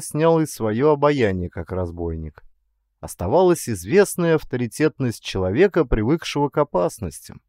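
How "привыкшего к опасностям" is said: plain reading voice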